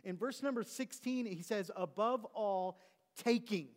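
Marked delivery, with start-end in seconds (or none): 1.7-3.7 "taking" pressed a bit louder at the end; slow "Above all", then a firm "taking"; preacher stressing "taking"; emphasis on taking. Shown as a demonstration. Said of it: "we emphasize the word taking".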